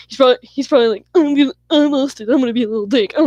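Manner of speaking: mock him in a silly voice to get a laugh; mocking Austin. Friend cheering up crying Melissa, silly mocking imitation of Austin. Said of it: "trying to make her laugh".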